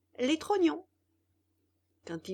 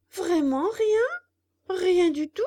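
higher, louder disbelieving plea; can't accept there's nothing; pleading puppet; 10 semitones higher, 10 dB louder than usual. Acted.

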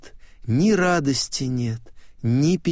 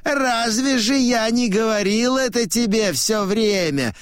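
high-pitched challenge; high-voiced old man; old man declaring; 10 semitones higher than usual. A narrator's reading. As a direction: throw the question out in a high, ringing voice. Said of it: "говорит он".

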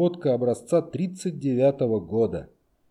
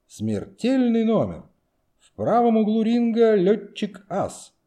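high, slow, booming boxing MC; ring announcer voice; 9 semitones higher, 4 dB louder than usual. Acted. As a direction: announce it like a ringside MC. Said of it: "подражая голосу конферансье".

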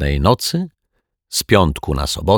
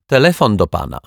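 a touch louder, still called from above; mild calling out; 4 semitones higher, 4 dB louder, faster than usual. A narrator's reading. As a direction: call it down to the yard. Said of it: "krzyknęła pani Ryczaj".

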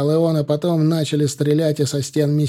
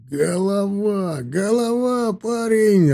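high, drawn-out gush of praise; effusive, moved exclamation; overcome with emotion; 7 semitones higher, much slower than usual. A narrator's reading. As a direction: heap delighted praise on the lad. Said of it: "расчувствовался Наливкин".

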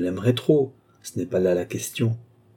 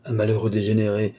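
plain reading voice

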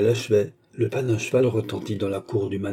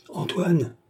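much higher call to a servant; 8 semitones higher than usual. A narrator's reading. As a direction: summon the old servant across the house. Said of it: "cria-t-elle".

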